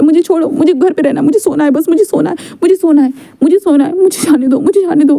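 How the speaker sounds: a frantic plea to stay home; desperate pleading. Acted. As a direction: beg to be left alone, rising in pitch. Wing resists being carried out.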